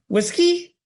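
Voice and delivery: very high rising whiskey; bird call. A narrator's reading. Johnny trying the room again, very high call. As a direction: squeak it up like a bird. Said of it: "Two notes on a rising scale".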